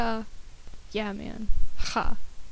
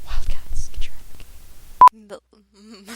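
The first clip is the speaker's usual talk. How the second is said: whispered quote; 2 semitones lower, 4 dB louder, much slower than usual. Retold or imitated. Quoting Troy Bolton coach line.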